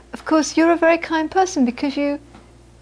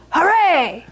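cheering hooray; 5 semitones higher than usual. Retold or imitated. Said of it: "all the students stand up".

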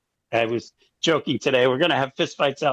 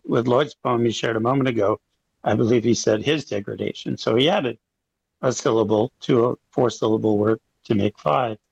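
ordinary talk

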